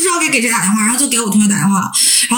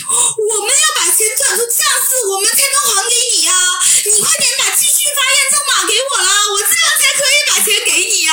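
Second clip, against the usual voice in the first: coaxing scammer; 13 semitones higher than usual. Retold or imitated. Scammer soothing the crying student to get the code, high wheedling. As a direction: sweet-talk her into sending the code.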